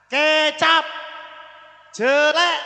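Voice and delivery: "Kecap", "Jelek" called out loud and stretched; heavy E demonstration. Shown as a demonstration. Teacher listing example words, loud and drawn out. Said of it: "berarti tambah berat. E".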